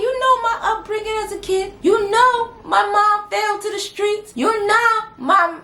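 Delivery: high, mock-tearful pleading; whiny pleading. Husband tells wife his sad upbringing, whiny pleading.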